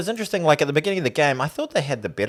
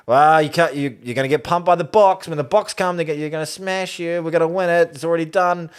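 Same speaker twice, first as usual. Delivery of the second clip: loud, brash boasting fan; cocky; 6 semitones higher, 7 dB louder than usual. Retold or imitated. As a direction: crow it like a smug fan. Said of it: "very cocky".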